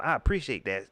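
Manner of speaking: usual speaking voice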